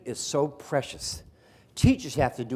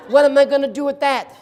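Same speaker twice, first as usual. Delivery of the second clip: high, loud imitated outcry; exasperated mother; 12 semitones higher, 10 dB louder than usual. Mother hears about the entomology minor, mock exasperated outcry. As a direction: mimic an appalled parent, loudly.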